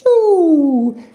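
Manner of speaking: very high, loud delighted ooh; excited squeal. Acted. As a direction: squeal over each room feature like a giddy tourist. Touring the room, squealing at features.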